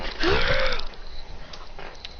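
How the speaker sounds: sharp high unvoiced intake of breath; charging gasp. Adrian powering up his blue fire, gasping.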